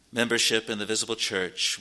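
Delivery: normal talking voice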